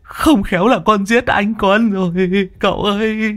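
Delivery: put-on Long whimpers to the young master, fearing he killed his boss; whimpering. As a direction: whine like he's about to cry. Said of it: "Long đầu đất mếu máo".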